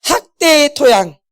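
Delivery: 학대의 토양 spelled out slowly, each word weighted; slow it down so 학대의 토양 sinks in; slow emphatic answer. Shown as a demonstration. Preacher answering own question to congregation, slow and emphatic. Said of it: "무슨 토양?".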